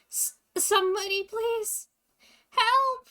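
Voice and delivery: high, loud desperate plea; screaming for help. Acted. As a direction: cry out for rescue, rising to a scream. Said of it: "Someone's screaming".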